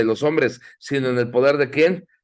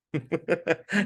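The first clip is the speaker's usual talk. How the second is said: short quiet chuckle; soft laugh; 8 dB quieter than usual. Real, not acted. Preacher laughing at disguised curses, soft chuckle. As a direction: laugh softly at the thought.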